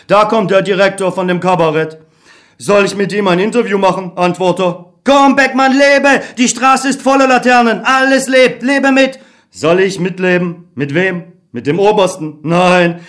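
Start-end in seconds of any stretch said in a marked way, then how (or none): 5.0-9.2 higher, urgent inner voice; optimistic Other. Acted. The Other urging Beckmann to trust people. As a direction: coax him to believe.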